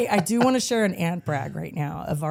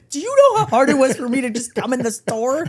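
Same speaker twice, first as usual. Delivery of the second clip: loud, higher-pitched, laughing rant; mock exasperated outburst; 7 semitones higher, 5 dB louder than usual. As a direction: blow up at a stranger, playfully. Wife acts out suggested outburst.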